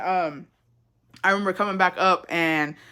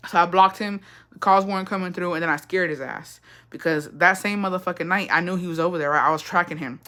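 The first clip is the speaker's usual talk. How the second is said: normal talking voice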